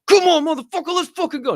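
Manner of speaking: high, loud hype yell; mock yell. Retelling his old yelling self, high loud yell. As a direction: bark it like a fired-up brawler. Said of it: "I used to fucking love yelling".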